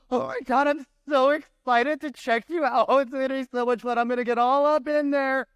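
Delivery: high, gushing giddy doctor voice; overexcited doctor. Retold or imitated. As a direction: squeal with creepy delight. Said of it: "and they're like".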